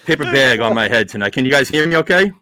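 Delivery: usual speaking voice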